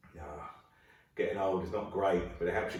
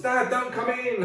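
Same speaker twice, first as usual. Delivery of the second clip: put-on high, slow teen voice; drawled kid warning off dad; high-pitched teenage son; son calling out; 13 semitones higher, 4 dB louder, slower than usual. Son shouting from room.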